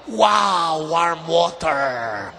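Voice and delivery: long, drawn-out mock amazement; delighted wow. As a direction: savour it slowly, hammed-up delight. Acting out a luxurious warm shower. (pinahabang manghang-mangha)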